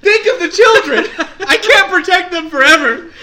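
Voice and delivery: loud, high, laughing melodrama; hammed-up plea. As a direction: overact the desperate plea through laughter.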